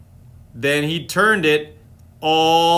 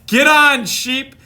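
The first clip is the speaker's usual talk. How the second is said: louder, higher call; the animals call the sheep to climb on, louder; mild shout; 7 semitones higher, 4 dB louder, slower than usual. Acted. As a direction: hurry the sheep, lightly shouted. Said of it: "everyone shouted".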